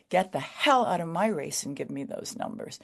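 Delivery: ordinary delivery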